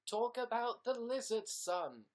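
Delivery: high, slow hammed-up proclamation; mock grand voice. Telling viewers what to remind him of, high mock proclamation. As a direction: announce it way up and slow, theatrical.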